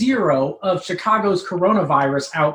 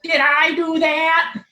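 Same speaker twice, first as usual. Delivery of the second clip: much higher, slow nerdy Urkel voice; the sheepish Urkel catchphrase, drawn out; 11 semitones higher, slower than usual. Acted. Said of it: "can only think of one thing to say".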